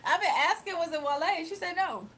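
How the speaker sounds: laughing high and loud. Real, not acted. Streamer laughing to chat about the name mixup. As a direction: crack up while telling it.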